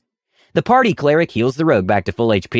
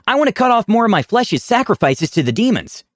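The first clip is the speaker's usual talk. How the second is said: put-on eager kid; 10 semitones higher than usual. Kid's eager creepy request.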